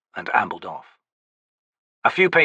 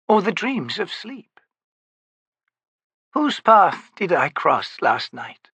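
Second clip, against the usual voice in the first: aged, dignified woman's voice, higher; old priestess voice; 8 semitones higher, slower than usual. Acted. Maibi's musing.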